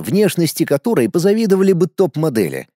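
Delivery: ordinary delivery, nothing marked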